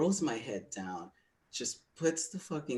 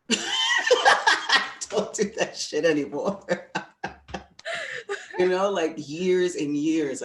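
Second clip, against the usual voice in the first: much higher, louder, slow, laughing through it; 9 semitones higher, 12 dB louder, slower than usual. Real, not acted. Speaker laughing at host about the past, high and loud. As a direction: squeal it out laughing. Repeats line, then laughs.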